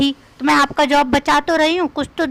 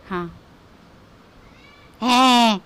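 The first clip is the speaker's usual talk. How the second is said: ordinary talk, nothing marked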